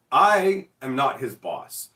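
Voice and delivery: slow, flat disclaimer; store owner deadpan. Retold or imitated. Store owner telling complainers he isn't the boss, slow. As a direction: drag it out, unbothered. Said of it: "he's like".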